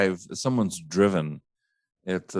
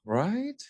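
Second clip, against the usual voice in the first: Right? softer but still rising high; a quieter high questioning Right?; upswing tail; 12 semitones higher, 4 dB quieter than usual. Shown as a demonstration. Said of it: "a little upswing".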